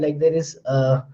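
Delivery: ordinary talk, nothing marked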